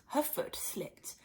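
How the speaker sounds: breathy hush at the slip; hushed whisper. A narrator's reading. Narrator whispering as Alice slips.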